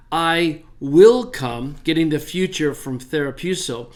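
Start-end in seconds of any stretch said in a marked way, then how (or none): 0.1-1.8 "will" leaned on, very slow and louder; slow statement hitting "will"; stress on WILL. Shown as a demonstration. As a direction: read it as a firm promise, landing on "will". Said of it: "state this as a statement".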